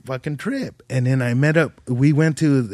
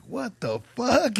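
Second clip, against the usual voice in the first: stunned; 9 semitones higher, 4 dB quieter, slower than usual. Retold or imitated. Bandleader stunned by his singing.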